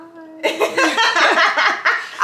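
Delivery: a bright burst of giggles; giggling laugh. Real, not acted. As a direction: laugh it off before starting. Laughing while warming up.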